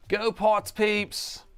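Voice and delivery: drawn-out faux British accent; a hammed-up British accent rallying the Parts peeps. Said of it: "trying to do a faux British accent".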